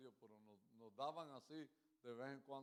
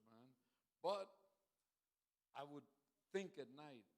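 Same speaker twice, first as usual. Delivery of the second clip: louder, higher preacher's testimony; preaching; 4 semitones higher, 6 dB louder than usual. Acted. Preacher testifying to the congregation, fervent. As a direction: build it up for the church.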